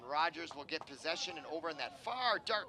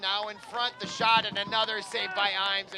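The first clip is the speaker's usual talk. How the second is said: louder, rising rush on the shot and save; excited call; 2 semitones higher, 8 dB louder than usual. Real, not acted. Commentator calling the goalie's save to viewers, excited and loud.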